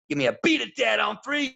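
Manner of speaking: high, loud rallying call; calling out to kids. Retold or imitated. Coach leading campers in a cheer, loud and high. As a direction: pump up the crowd of kids.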